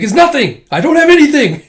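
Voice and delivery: shouted high and loud, flustered; panicked denial. Retold or imitated. Panicked denial hiding the cakes.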